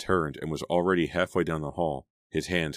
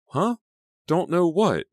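high, loud, baffled question; demanding; 14 semitones higher, 8 dB louder, slower than usual. A narrator's reading. Girl out of the loop to her friend, demanding. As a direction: insist on an answer, confused. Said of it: "Selina demanded".

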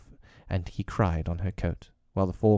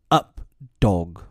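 cry out; 6 dB louder than usual. Acted. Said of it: "Mowgli cried".

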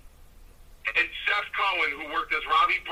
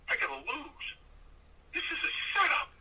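panicked dread. Retold or imitated. Panicked realization in ring.

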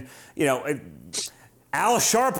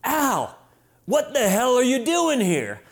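retold yell; 5 semitones higher, slower than usual. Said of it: "I just yell across the room".

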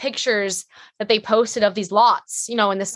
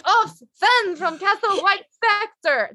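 high, slow exaggerated French accent; imitating the costume designer's French accent; worst French accent; 10 semitones higher, slower than usual. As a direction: ham up a terrible French accent. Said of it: "I'm doing the worst French accent in the world".

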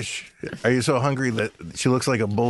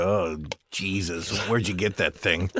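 imitating disgusted mom; 6 semitones lower, 3 dB quieter, slower than usual. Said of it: "She'd go".

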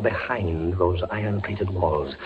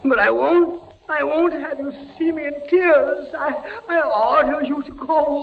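high, strained voice near tears; fighting back tears; 4 dB louder than usual. Acted. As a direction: order her away through tears. Refuses to let her see tears.

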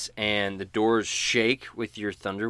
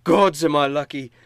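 loud, higher relieved exclamation; relieved hardy man; 7 semitones higher, 12 dB louder than usual. Acted. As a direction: blurt it out in relief. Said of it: "and he says".

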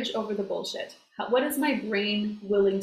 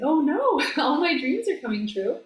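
high, playful mock alarm; light, hammed-up "oh no"; mock dismay; 6 semitones higher than usual. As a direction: pretend to panic, laughing at the absurd problem. Voices a mock panic at success.

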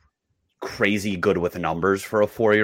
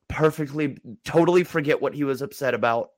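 usual speaking voice

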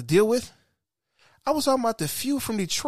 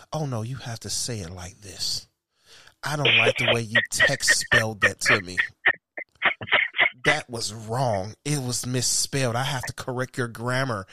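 mock grammar critic; 9 semitones lower than usual. Mimics a texting grammar critic.

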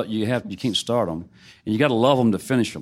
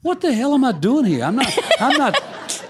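much higher, laughing disbelief at himself; laughing exasperation; 12 semitones higher than usual. Retold or imitated. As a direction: laugh it out, high and incredulous. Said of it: "the whole time I'm thinking".

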